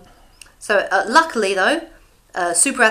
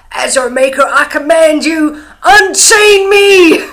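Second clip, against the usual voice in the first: hammed-up shout, slow and high, building louder; commanding call-out; 7 semitones higher, 12 dB louder, much slower than usual. Bill ordering Jessica to unchain him, mock commanding shout. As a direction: bellow a mock vampire command, stretching the last words. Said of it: "Bill calls out".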